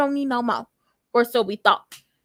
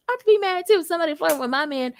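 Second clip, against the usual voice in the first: ordinary talk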